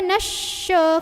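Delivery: "nasya" with a long sh; wrong shin sound. Shown as a demonstration. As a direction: misread "nasya" slowly with a hushing sh. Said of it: "Bukan juga".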